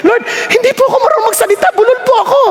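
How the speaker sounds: high, rushed plea to God; acted nervous excuse; pleading objection. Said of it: "ganun ang tanong ko eh".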